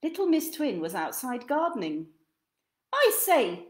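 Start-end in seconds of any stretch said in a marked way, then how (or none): 2.9-3.7 polite call across the garden; calling to a stranger politely. Acted. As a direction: call out to her over the fence. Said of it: "called out Mister Nosey".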